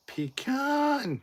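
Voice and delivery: "pecan" as pee-CAAAN, high and held; hammed-up long, high "pecan"; drawn-out joke word. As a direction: stretch the CAN of "pecan" upward. Repeats the running shouted joke.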